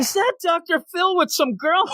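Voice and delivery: very high comic squeal of disbelief; mock astonishment. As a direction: gawk aloud, hammed-up and high-pitched. Play-acts startled sighting on beach.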